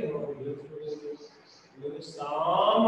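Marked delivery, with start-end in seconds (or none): 2.0-2.9 teacher sounding out शाम slowly; slow blend. Shown as a demonstration. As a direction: stretch शाम, leaning on शा. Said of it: "सा को जोर से बोलेंगे".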